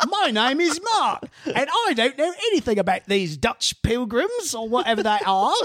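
high, hammed-up English accent; mock English voice with laughter creeping in; Mary Read. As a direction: protest innocence in a comic English accent. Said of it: "Did I just hear an English accent".

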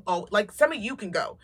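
normal talking voice

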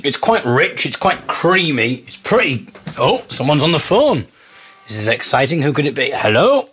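usual speaking voice

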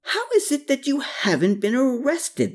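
high-pitched, gleeful teasing. A narrator's reading. Man ribbing his friend about arrest, gleeful. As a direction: tease a friend with open glee. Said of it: "with his glee unrestrained".

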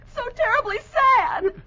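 high, tearful comic whimper; crying. Acted. Bessie explains to Gildersleeve why she is crying, tearfully. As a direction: blubber it out helplessly. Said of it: "Why are you crying?".

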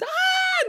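very high-pitched mock scream; falling scream. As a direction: shriek as someone thrown off a cliff. Voices falling Hilda's scream.

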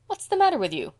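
high, loud astonished question; astonished demand. A narrator's reading. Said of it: "demanded justly astonished Missus Lynde".

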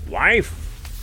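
high, sudden giant's call; giant's cry. A narrator's reading. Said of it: "cried the giant".

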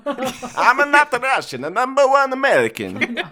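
put-on hammy Italian accent with a laugh. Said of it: "It just keeps morphing".

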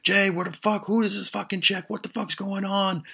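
high-pitched, outraged mock yell; imitated yelling. Chris yelling to Jay about the stranger, imitated shouting. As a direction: holler it at the top of the voice. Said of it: "Then I hear Chris saying".

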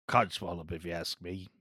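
a low, quiet character voice; Robbie Coltrane. Acted. Host attempting Hagrid's actor for the cohosts, low impression. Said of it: "closest I'm gonna get to a Robbie Coltrane voice".

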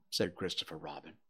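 ordinary delivery

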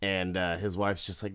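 ordinary talk

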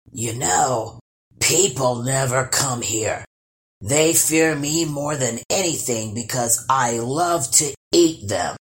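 low, rumbling troll brag; boasting troll. Acted. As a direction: brag like a proud man-eating troll. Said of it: "he boasted".

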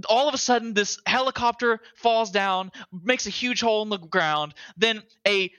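breathless hype about the game; excited rant. Real, not acted. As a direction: pile on the chaos, voice climbing. Excited recap of game chaos.

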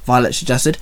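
ordinary delivery, nothing marked

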